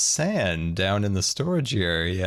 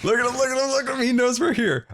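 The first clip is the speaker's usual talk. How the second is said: excited squeaky warning; alarmed squeal; 13 semitones higher, faster than usual. Real, not acted. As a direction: point him out in high alarm. Alarmed at troll noticing.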